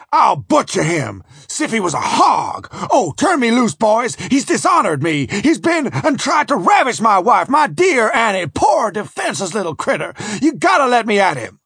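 high, outraged husband's rant; furious yelling. A narrator's reading. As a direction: yell wildly to be let loose. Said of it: "with Mandeville yelling".

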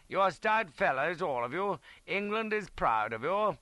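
high, ringing praise from an officer; rally the wounded men with pride; patriotic speech. A narrator's reading. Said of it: "a few patriotic words".

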